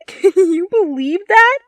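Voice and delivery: high, loud laughing question; laughing gossip. A narrator's reading. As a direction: laugh through it with glee. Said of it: "The girl laughed".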